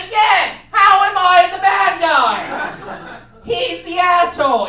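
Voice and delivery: high, loud, drawn-out protest; indignant shouting. Retold or imitated. Storyteller to manager who told him off, outraged shouting. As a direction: yell in exasperated disbelief.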